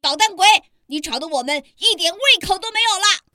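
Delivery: high, fast scolding monster parents. A narrator's reading. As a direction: snap at the noisy child. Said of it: "就大声地训斥他".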